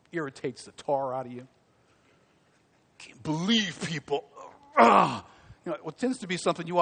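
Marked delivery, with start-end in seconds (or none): 3.0-5.2 mock disgusted groan; exasperated. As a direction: huff it out like someone fed up. Acts irritation at others.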